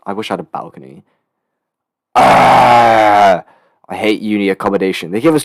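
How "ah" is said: loud, drawn-out "aah" of frustration; exasperated yell. Real, not acted. Venting about uni accommodation, exasperated yell. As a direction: let out a long loud groaning yell.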